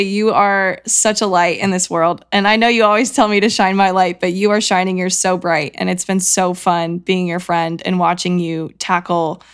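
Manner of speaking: ordinary delivery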